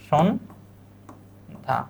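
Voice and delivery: slow teacher demo; slow pronunciation demo. Shown as a demonstration. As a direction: draw out সন্ধ্যা gently. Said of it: "সন্ধ্যা শব্দটা কি?".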